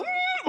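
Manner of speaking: high-pitched mock strain; straining grunt. She tries to lift her paralysed body, mock straining. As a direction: squeeze out a high effortful "mm".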